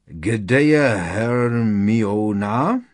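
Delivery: very slow, loud, laboured character voice; Krum. Acted. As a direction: drag the question out heavily and loudly. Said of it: "Byl to Krum". (pomalý, hlasitý hlas postavy)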